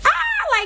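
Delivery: short high mock scream "Ah!"; retold scream. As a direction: yelp it up high like a startled girl. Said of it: "I literally screamed, like, out loud".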